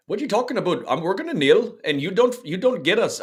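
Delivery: high-pitched, hammed-up Canadian accent; horrible Canadian accent. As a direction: put on a bad, high Canadian voice. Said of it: "That was a horrible Canadian accent".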